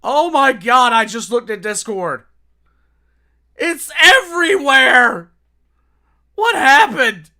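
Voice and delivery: high, very loud outburst of disbelief; shocked shouting. Real, not acted. Streamer reacting to what turned up in Discord, shocked shout.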